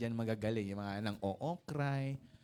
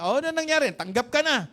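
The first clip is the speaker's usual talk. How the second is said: louder, higher hopeful asking; a father hoping for good news; excited dad asking about the audition; eager father; 8 semitones higher, 9 dB louder than usual. Acted. Eager father asks son.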